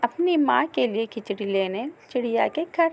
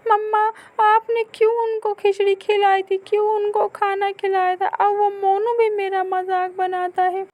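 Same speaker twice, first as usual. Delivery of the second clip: put-on high, loud complaining child; hurt little boy; 12 semitones higher, 4 dB louder than usual. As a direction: run to mother with the hurt. (माँ से ऊँची आवाज़ में शिकायत करता बच्चा)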